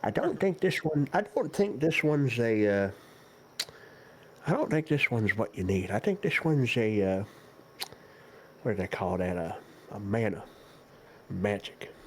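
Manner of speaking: lightly voiced doddering old shopkeeper; very old man. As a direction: ramble on like an old turtle. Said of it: "God, he's ancient".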